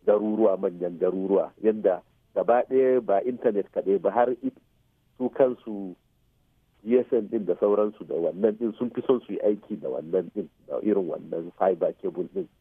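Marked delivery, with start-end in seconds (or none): none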